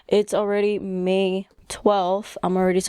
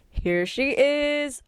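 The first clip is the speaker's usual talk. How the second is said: a higher, slow proud reveal; 7 semitones higher, much slower than usual. Real, not acted. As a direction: present her like a prize. Showing off the finished plushie.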